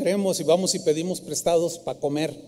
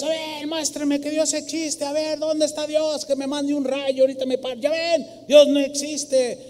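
high mocking challenge, pitch well up; ranting atheist; 7 semitones higher than usual. Preacher voicing an atheist taunting God in a plaza, high and mocking. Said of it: "despotricando contra Dios".

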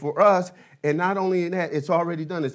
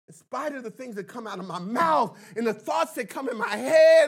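a pastor building up to a raised, fervent preach; preaching at full force; 4 semitones higher, 4 dB louder than usual. Acted. Pastor to his congregation about David, preaching with growing fire.

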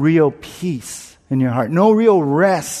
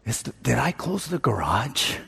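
quiet worried mutter to himself; hushed inner worry; anxious muttering; 2 semitones lower, 10 dB quieter than usual. Retold or imitated. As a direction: fret under your breath. OCD worry about the garage.

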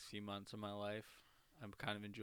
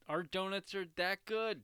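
mock shop owner; 8 semitones higher, 7 dB louder than usual. Mock donut shop boast.

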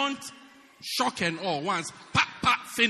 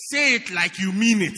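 loud scolding demand; louder wife's order; 8 dB louder than usual. Retold or imitated. Preacher voicing the wife, shouting her order. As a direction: order him to mean it.

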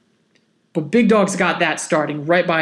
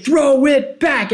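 drawn-out, higher stadium chant; imitated crowd yell; 5 semitones higher, slower than usual. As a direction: stretch out each word like a yelling crowd. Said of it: "yelling and the stadium's saying".

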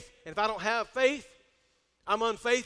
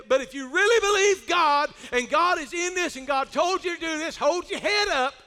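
raised, urgent preacher's encouragement; rally a wavering pastor, voice lifted; rousing exhortation; 6 semitones higher, 5 dB louder than usual. Acted. Acts bishop's rousing pep talk.

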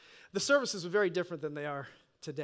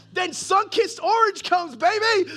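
loud, high and slow, savoring it; crowing over the next soda; gleeful shout; 11 semitones higher, 12 dB louder, slower than usual. Retold or imitated. Teen boasts about drinking soda in the basement, loud.

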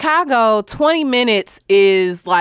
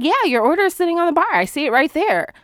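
higher, fast chatty voice; imitating the manager; 7 semitones higher, faster than usual. Manager on the phone says the order sits on the bar, pitched up and fast. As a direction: rattle it off, pitched up. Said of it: "So she was like".

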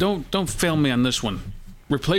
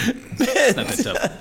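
high laughing protest; 10 semitones higher, much slower than usual. Real, not acted. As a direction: laugh it out slowly.

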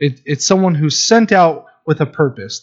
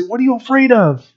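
gentle dad question; 9 semitones higher than usual. Retold or imitated. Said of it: "And I say".

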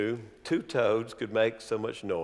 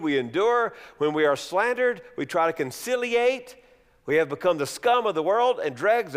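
sarcastic; 4 semitones higher than usual. Shown as a demonstration. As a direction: sneer at their pride. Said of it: "now he's saying".

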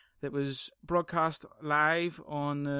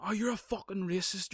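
higher-pitched mock accusation; mock accuser; 6 semitones higher than usual. Podcaster mimicking name-callers in a conversation, high mock outrage. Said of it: "instead of just going".

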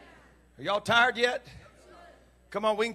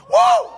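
loud, very high "woo"; piercingly high, joyous holler; shouted whoop; 16 dB louder than usual. Shown as a demonstration. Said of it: "shout that right now".

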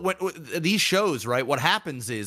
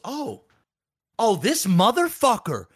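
mock indignation; 6 semitones higher than usual. Voicing girl seeing edited kiss footage.

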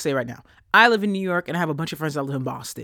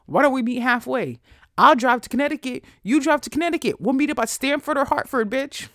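heated rant; 8 semitones higher, 4 dB louder than usual. Real, not acted. Ranting offer to meet halfway.

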